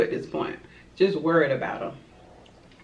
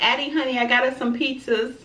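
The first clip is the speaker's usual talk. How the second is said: lightly voiced dad, higher and sugary; a hint of a dad sweet-talking his daughter; dad calling to his daughter with pizza, mildly sweet; 7 semitones higher than usual.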